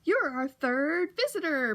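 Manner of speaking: loud, slow, jolly announcer boom; hearty ceiling announcer proclaiming. A narrator's reading. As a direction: boom it out like a game-show host. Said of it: "boomed the jolly announcer".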